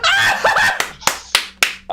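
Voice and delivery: very high, breathless cackle; loud shrieking laughter. Real, not acted. Hearing he was their shot caller, helpless laughter. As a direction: burst out laughing hard.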